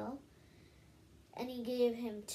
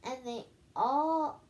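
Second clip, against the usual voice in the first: usual speaking voice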